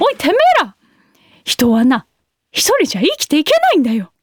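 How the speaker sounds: hammed-up delinquent-teacher lecture; Yankumi impression. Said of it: "ヤンクミです。". (笑いながらヤンクミのモノマネ)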